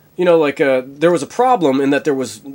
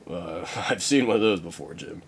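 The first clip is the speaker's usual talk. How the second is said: lower, softer weary doctor; 6 semitones lower, 8 dB quieter than usual. Acted. Bones tells the captain he's seen one before, low. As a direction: grumble it low and dry.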